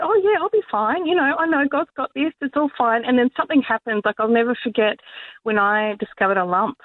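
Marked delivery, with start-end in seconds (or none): 0.0-3.0 light, carefree and high; breezy carefree voice. Retold or imitated. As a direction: toss it off like nothing's wrong. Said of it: "We, we think that".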